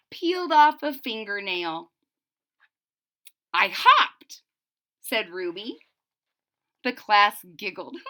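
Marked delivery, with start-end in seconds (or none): 3.5-4.4 higher, louder eager answer; proud reply. Acted. Ruby telling the class what she did, eager. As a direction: announce it proudly.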